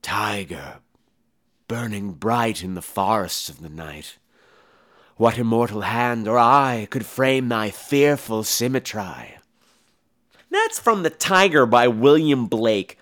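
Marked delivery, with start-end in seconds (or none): 0.0-9.4 soft, slow poem reading; poem recitation. Acted.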